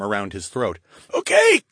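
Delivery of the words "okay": high strained choking plea; choked out. Acted. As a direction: squeeze it out while being choked. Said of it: "the mage choked out".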